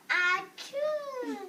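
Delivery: loud sneeze, leaping high; mock sneeze. Gerald cannot stop sneezing, mock sneeze. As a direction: break off into an acted "achoo". Story character sneezes mid-word.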